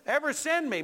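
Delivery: high, indignant challenge; ticked-off Moses. Acted. Preacher as Moses with a real issue, ticked off. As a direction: protest it as a wronged Moses. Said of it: "you're ticked off".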